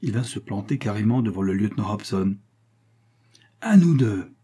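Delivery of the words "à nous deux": high, falling cry of challenge; exclaimed challenge. A narrator's reading. Angry astronomer confronts the lieutenant, exclaimed challenge. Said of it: "s'écria-t-il".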